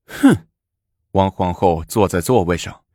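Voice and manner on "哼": very high, sharp huff; scornful huff. A narrator's reading. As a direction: huff in contempt. Empress scoffs at crying consort. (轻蔑地冷哼)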